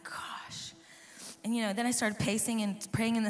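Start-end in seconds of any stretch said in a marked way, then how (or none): 0.0-0.7 whispered. Retold or imitated. Voiceless morning, no voice.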